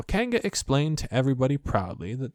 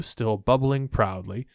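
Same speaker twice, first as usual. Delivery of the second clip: ordinary delivery, nothing marked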